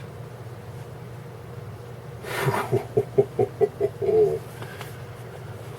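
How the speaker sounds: soft breathy laugh. Real, not acted. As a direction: chuckle at the thought. Laughs at own joke.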